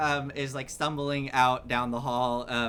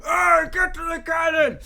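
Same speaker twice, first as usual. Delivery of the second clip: high, loud shout across the deck; crew shout; 10 dB louder than usual. Acted. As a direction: yell orders to the gun crew.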